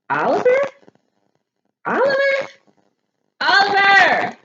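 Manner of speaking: rising calls up the stairs, loud and high; calling Oliver. Acted. Mom calling up to Oliver behind the bathroom door, shouting. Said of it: "shouted Mom".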